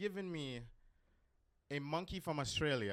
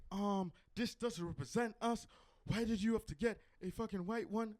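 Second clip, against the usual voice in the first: higher-pitched mock complaint from a girlfriend; upset girlfriend; 7 semitones higher than usual. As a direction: imitate an offended girlfriend, mildly indignant. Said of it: "she was angry".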